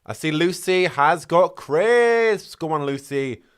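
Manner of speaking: slow, loud, high singsong tease; teasing. Real, not acted. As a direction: draw it out to tease her. Teasing a viewer eating crisps.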